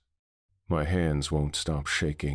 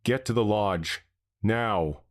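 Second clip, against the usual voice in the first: mild urgent yell to flee; 5 semitones higher than usual. A narrator's reading. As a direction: bark the order to run. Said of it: "Frank yells at us".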